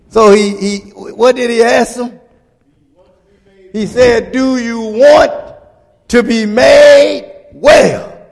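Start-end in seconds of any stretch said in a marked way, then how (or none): 4.3-8.2 slow, loud, pleading call; Jesus voice. Acted. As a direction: draw it out like Jesus calling to the sick man. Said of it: "Jesus asked him that".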